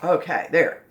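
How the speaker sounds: normal talking voice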